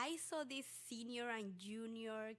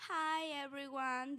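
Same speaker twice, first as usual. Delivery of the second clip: loud, raised-pitch greeting to a room; announcing to a class; 4 semitones higher, 7 dB louder, slower than usual. Retold or imitated. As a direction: imitate a secretary addressing the whole class. Secretary introduces student to the class.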